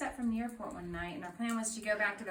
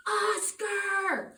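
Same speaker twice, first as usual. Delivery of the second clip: much higher and louder mock outrage; exasperated shout; 12 semitones higher, 9 dB louder than usual. Couple scolding Oscar for the forgotten warning, shouting. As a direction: yell his name in disbelief.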